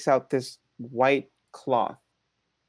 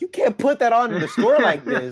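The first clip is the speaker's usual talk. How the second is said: high, louder retold protest; mock outrage; 9 semitones higher, 5 dB louder than usual. Son tells masked dad off in store, mock outrage. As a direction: protest in disbelief, half amused.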